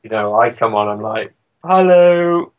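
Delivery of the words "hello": high, loud mock-British greeting; an exaggerated uncool British hello; typical British geek. Sending up his own entrance after cool bands, typical British geek. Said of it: "Just typical British".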